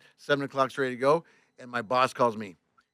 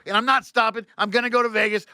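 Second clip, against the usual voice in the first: louder, high-pitched refusal; defiant; 7 semitones higher, 5 dB louder than usual. Retold or imitated. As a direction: refuse the boss outright. Retold defiance of boss.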